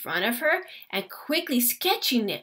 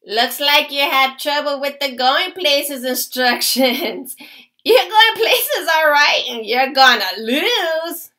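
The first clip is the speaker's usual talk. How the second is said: put-on a taunting kid, louder, laughing through it; mocking laugh; 2 semitones higher, 6 dB louder than usual. A narrator's reading. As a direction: jeer at the odd kart. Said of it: "One of the kids laughed".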